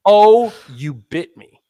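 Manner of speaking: slow, loud drawn-out mock yelp; slow mock hurt. Patient replays assistant's yelp, slow and hammed-up. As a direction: stretch it out in mock shock. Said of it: "And the assistant says".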